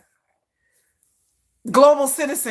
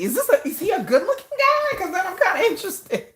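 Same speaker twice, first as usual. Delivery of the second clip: laughing; 9 semitones higher than usual. Real, not acted. Jokingly asking if he's handsome.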